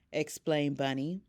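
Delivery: ordinary delivery, nothing marked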